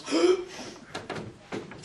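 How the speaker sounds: a sharp high gasp. Retold or imitated. Friend Carlos at the funeral, gasping high in shock. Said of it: "Carlos goes".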